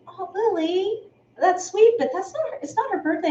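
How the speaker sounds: lightly voiced mom gently correcting her toddler; imitating Tasha. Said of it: "Tasha's like".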